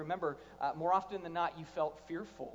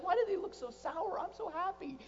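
naive cheer; 11 semitones higher than usual. Retold or imitated. New believer puzzled by sour Christians.

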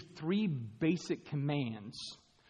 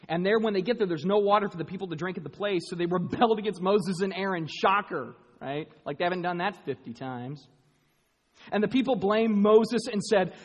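loud, high, driving sermon delivery; full-force preaching; 9 semitones higher, 11 dB louder than usual. Acted. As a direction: preach it out to the whole hall.

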